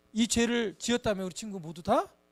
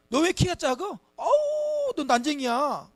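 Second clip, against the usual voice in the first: a mock bully's taunt, pitched higher and louder; bullo che deride; 6 semitones higher, 5 dB louder, slower than usual. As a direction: tease like a playground bully, with a squeal on 어우. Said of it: "막 이렇게 하고".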